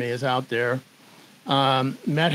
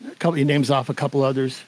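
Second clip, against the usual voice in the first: usual speaking voice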